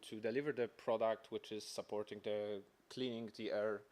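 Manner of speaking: ordinary talk, nothing marked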